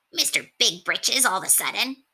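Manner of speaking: lightly high rat, mocking; teasing rat voice. A narrator's reading. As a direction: needle him playfully. Rat character voice.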